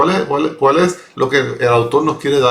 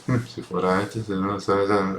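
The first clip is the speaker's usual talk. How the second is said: muttered under the breath, much softer; muttering; 5 semitones lower, 8 dB quieter than usual. Retold or imitated. Pharisee grumbling to himself about Jesus, muttered softly. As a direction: grumble to yourself so no one hears. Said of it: "está murmullando".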